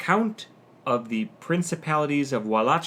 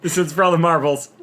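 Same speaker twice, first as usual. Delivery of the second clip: dramatic hype; 4 semitones higher, 8 dB louder, faster than usual. Acted. Dramatic final-question hype.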